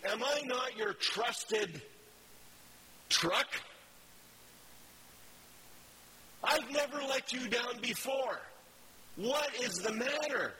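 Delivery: a lightly voiced pleading donkey, rising toward a shout; the hurt donkey protests, voice climbing; mild indignant donkey cry; talking donkey.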